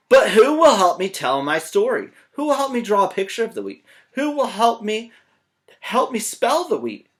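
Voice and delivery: loud, high, whiny hen; pleading hen. Acted. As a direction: beg desperately for help.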